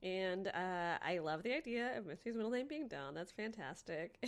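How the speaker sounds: ordinary delivery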